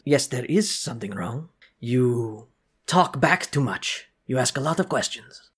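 a guard reproaching the construct; stern guard voice. Acted. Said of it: "The guard says".

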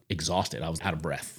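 ordinary delivery

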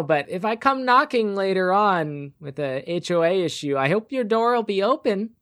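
high, sweet busybody neighbor voice; sugary HOA lady. Acted. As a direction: purr it like a pushy neighbor. GM plays Glenda, HOA president.

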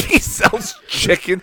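cracking up on the words; laughing. Real, not acted. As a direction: repeat it through real laughter. Cracking up at co-host's joke.

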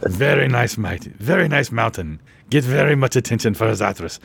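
low-pitched, halting character voice; comic impression, dropping in pitch; Zathras. Plays Zathras admiring the mountain.

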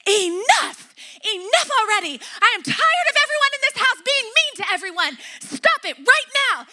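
a mother's exasperated shout to stop the fighting; fed-up mom. Retold or imitated. Mom shouts at her quarreling daughters, loud and fed up. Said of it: "and then I'm like".

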